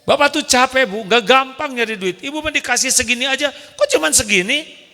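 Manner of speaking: high, fast exasperated husband; mock angry husband. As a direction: scold the wife about money. Angry husband complaining about money.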